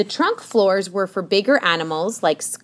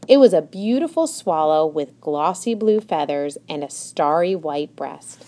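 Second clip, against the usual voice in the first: usual speaking voice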